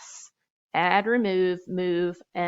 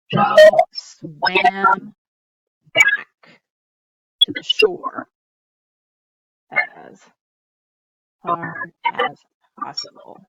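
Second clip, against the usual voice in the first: dramatic reading; 11 dB louder, much slower than usual. Acted. Dramatic reading of added sentence.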